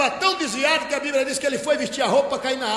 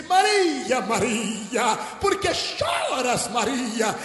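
calling by name; much slower than usual. Acted. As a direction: cry out the question, stretching it. Jesus calls weeping Mary by name.

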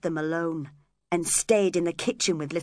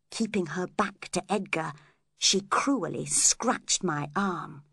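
plain reading voice

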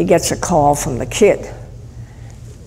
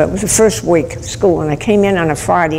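usual speaking voice

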